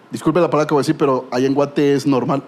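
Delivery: ordinary talk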